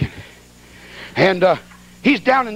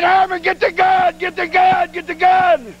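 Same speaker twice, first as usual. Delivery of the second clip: high, slow, desperate calling; panicked plea; 9 semitones higher, slower than usual. Retold or imitated. Preacher pleading with Herman for the gun, high frantic plea. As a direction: beg him to grab the gun, frantic. Begging Herman to shoot bear.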